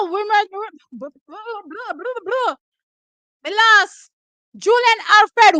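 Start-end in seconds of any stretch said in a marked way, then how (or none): none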